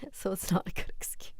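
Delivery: breathy whisper, teasing; whispered aside. Real, not acted. Streamer whispering a teasing jab at chat. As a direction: drop to a whisper for the dig.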